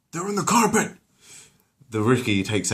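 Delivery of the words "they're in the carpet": high, loud alarm; battle shout. Acted. Exterminator yelling to the squad, high and loud. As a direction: warn the squad.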